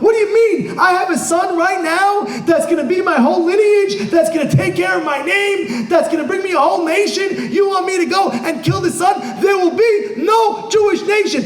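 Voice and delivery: acted high-pitched, urgent objection; incredulous protest. Preacher voicing Abraham's objection to losing his son, acted incredulous protest.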